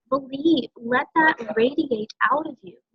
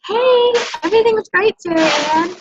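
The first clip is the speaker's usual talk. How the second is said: higher, louder bright fake-cheery reply; chirpy brush-off; 9 semitones higher, 5 dB louder than usual. Prospect answering a check-in, higher and louder cheery brush-off. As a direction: toss it off all sunny.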